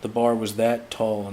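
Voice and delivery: usual speaking voice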